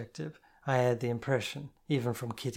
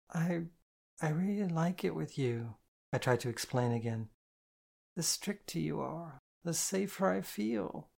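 put-on drunk confession; 5 semitones higher than usual. A narrator's reading. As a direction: confess it shyly, a bit tipsy. Drunk girl confessing to partner.

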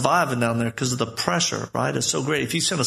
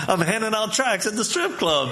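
laughing through it; 6 semitones higher than usual. Retold or imitated. Joking about an overzealous brother.

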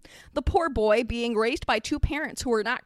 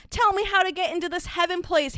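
lightly voiced eager child, pitched up; a hint of a yearning little boy; wistful cry; 7 semitones higher, 3 dB louder than usual. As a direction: plead wistfully in a higher voice. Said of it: "Harry cried wistfully".

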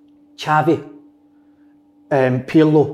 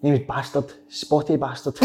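ordinary talk, nothing marked

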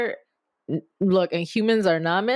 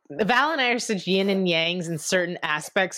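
usual speaking voice

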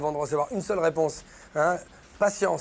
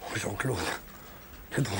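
normal talking voice

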